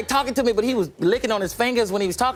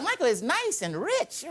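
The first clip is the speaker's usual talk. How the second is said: slow gleeful; 7 semitones higher, 4 dB quieter, slower than usual. Retold or imitated. Gleeful aside.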